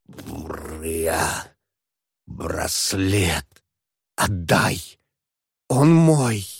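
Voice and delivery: low, moaning ghostly voice. Acted. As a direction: groan it out like a restless ghost. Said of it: "из уст вырывался только стон".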